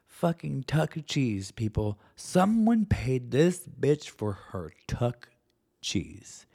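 normal talking voice

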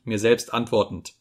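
plain reading voice

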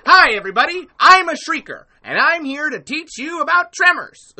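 high, loud presenter-like greeting; terrible Zoran impression. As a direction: introduce yourself in a badly faked, hammed-up voice. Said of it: "that's a terrible Zoran impression".

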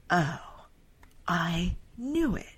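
slow, breathless whisper of discovery; hushed mock revelation; whispered realization. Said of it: "then Rand is like".

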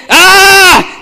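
high loud mock yell of a possessed man; imitated scream. Preacher acting out the possessed man's scream, a loud mock yell. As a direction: belt out a short cry for the tormented man. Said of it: "Sabi niya".

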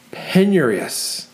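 slow, savoured Penurious; drawn out. Acted. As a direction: stretch "Penurious" out syllable by syllable. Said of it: "Matthew chokes again".

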